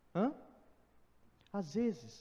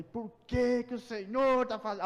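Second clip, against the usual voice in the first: louder, higher shout at God; angry outburst; 6 semitones higher, 7 dB louder than usual. Retold or imitated. Preacher retelling his rage at God over broken car, loud shout. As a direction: yell it while pounding the steering wheel. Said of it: "eu falei".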